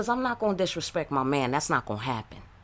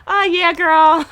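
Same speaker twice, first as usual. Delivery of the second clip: put-on higher, louder, gushing girl voice; mock fawning girlfriend; 12 semitones higher, 11 dB louder, slower than usual. Imitating another woman sweet-talking, hammed-up and higher. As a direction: ham up a gushing friend.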